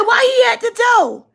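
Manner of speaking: high loud indignant yell; outraged shout. Retold or imitated. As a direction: shout it, shocked and angry. Outraged at dad.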